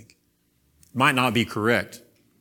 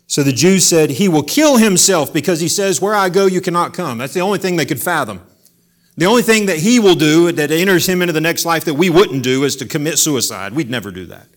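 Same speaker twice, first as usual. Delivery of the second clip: louder, higher impassioned preaching; forceful preaching; 6 semitones higher, 10 dB louder than usual. Acted. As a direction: drive the point home loudly.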